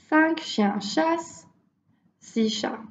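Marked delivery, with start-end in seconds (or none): none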